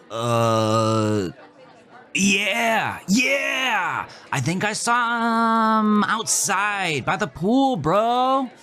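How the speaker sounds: a dopey, glassy-eyed stoner greeting the quarterback; stoned party guy. Acted. Stoner recalling with effort.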